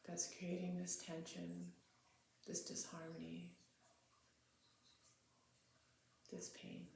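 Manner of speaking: quiet, spacious meditation leading, phrase by phrase; guided meditation. Real, not acted. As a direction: settle into a hushed, unhurried meditative prayer.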